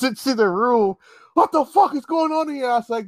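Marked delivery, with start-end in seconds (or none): 1.3-2.7 high-pitched indignant outburst, acted; outraged father. Father catches boy with his daughter, acted outrage. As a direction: imitate a dad bursting in, appalled.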